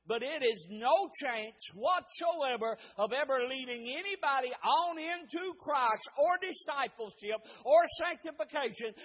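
high, loud shouted exhortation; fervent pulpit shouting; full-force preaching. Real, not acted. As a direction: drive it home at a shout, calling for an amen.

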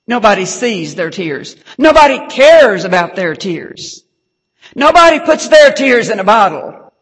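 rising from firm to loud, top of the voice on "bottle"; building preaching. Acted. Preacher to congregation about unseen tears, building to a loud cry. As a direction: drive it up line by line like a pulpit crescendo.

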